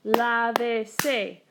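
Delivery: slow, drawn-out "lávese", each syllable separate. Shown as a demonstration. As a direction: sound out "lávese" in three slow beats. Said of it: "three syllables".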